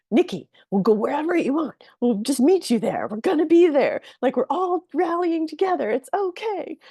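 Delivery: high, loud voices of rallying clients; imitating insistent, high-pitched cheerleading support; emphatic rallying reassurance. Voices clients rallying round her.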